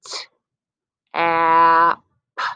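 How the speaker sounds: slow blending sounds; slow sliding sounds. Shown as a demonstration. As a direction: slide through each sound, held long. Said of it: "slide to those sounds".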